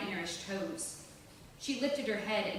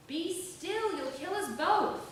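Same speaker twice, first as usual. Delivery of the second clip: urgent snap; 9 semitones higher, slower than usual. Acted. Rescuer cursing while swimming.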